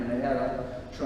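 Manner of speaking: ordinary talk, nothing marked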